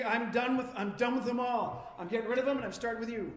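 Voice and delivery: acted exasperation, firing the words out; fed-up outburst. God telling Moses he is done with them, acted anger.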